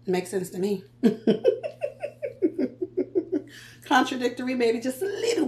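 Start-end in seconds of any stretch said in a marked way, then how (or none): 1.0-3.6 high giggling laugh at herself. Real, not acted. Vlogger laughing at her own contradiction, high giggle.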